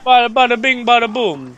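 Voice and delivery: high, loud mock New York Italian; Italian New York accent. Gamer clowning with a friend, loud high Italian New York accent. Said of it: "That's my Italian New York accent".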